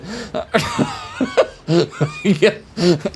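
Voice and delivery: long wild cackle; wild rambling cackles. Real, not acted. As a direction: cackle wildly. Laughter after the yell.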